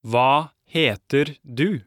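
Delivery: slow, careful Hva heter du; over enunciating. Shown as a demonstration. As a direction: spell out each syllable slowly. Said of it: "let's try it one more time slowly".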